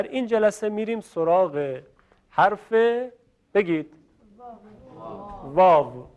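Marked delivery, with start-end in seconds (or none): 5.4-6.1 rounded lips, Arabic «واو» with a w-glide; Arabic waw. Shown as a demonstration. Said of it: "واو درسته یا واو؟".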